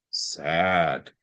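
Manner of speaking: low, soft drawn-out sad. Shown as a demonstration. Tutor showing emotion in reading, sad. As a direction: let the word droop, low. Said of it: "what emotion do you put in your voice".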